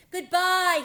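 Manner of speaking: loud, high farewell shouted across the water; called out. A narrator's reading. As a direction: call goodbye to friends drifting away. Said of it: "he called after them".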